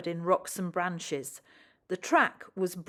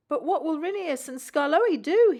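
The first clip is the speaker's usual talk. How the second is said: upset; 9 semitones higher, 6 dB louder than usual. A narrator's reading. Rusty upset at line closing.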